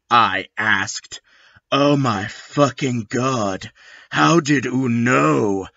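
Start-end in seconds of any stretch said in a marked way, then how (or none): none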